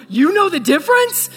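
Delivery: high, eager question, jumping up at the end; a man lighting up at a shared interest; excited. Retold or imitated. Fan asking the pastor eagerly, loud and high. Jacob's eager reply, retold.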